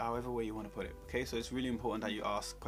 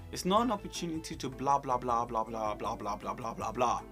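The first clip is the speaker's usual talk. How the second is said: mock rambling; 4 dB louder than usual. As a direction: parody someone droning on. Mocks rambling interview answer.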